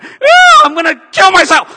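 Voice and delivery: shrieking high then loving; wild mood swing. Retold or imitated. Believer swinging wildly between moods, shrieking. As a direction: flip from despair to joy. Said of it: "like a schizophrenic".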